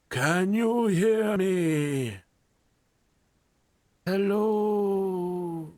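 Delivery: high, slow call from far away; ghostly voice. Acted. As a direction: call out like a spirit in the mist. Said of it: "you can hear a voice going".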